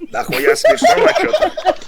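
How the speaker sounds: words squeezed out through a high laugh. Real, not acted. Teammate laughing while mocking the old man.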